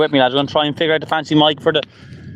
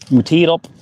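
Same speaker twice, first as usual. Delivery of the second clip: ordinary delivery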